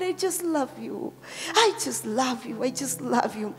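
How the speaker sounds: soft, low murmur of devotion; tender prayer. Acted. Pastor showing how to tell God of love, tender prayer. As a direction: say it softly, straight to God.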